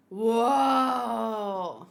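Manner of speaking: normal talking voice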